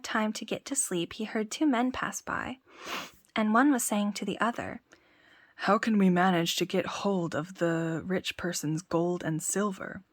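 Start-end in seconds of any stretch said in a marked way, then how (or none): 5.7-10.0 a hint of a low sly thief; lightly voiced thief. One thief asks the other, lightly low and sly. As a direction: talk low like a plotting crook.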